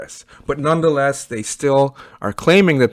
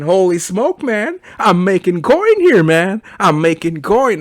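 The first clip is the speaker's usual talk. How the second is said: higher, louder pretend glee at the balance; hammed-up excitement of a rich man; mock excited; 7 semitones higher, 5 dB louder than usual. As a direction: play yourself gloating over easy money. Imagined self checking rich balance.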